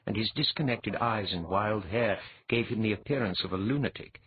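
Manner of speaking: ordinary delivery, nothing marked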